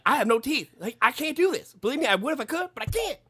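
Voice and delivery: hammed-up whining refusal; imitating Little B whining he can't; whiny teen. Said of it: "It's like".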